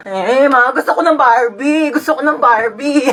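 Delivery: put-on bratty kid wailing for a toy; hammed-up child tantrum; whining child. Child wails for Barbie.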